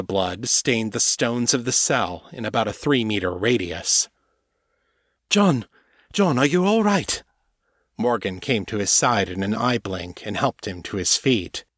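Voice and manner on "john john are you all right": urgent, high and loud call of his name; alarmed calling out. Acted. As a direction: call out to him in alarm, fearing he is hurt. Morgan calls to dazed John.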